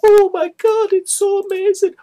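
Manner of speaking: hammed-up falsetto imitation of her gushing; mock freak-out. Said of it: "And she's like".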